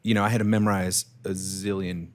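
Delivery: usual speaking voice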